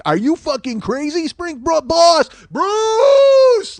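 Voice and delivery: high, mounting yell into a long howl; outraged fan yelling. Acted. Rabid fans haranguing a concert critic, yelling in his face. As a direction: explode in hammed-up disbelief, ending on a drawn-out bellow. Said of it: "they yell it".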